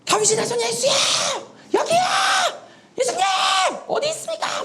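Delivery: very high, straining acted shouts; screaming out. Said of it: "막 이렇게 소리 지르면서".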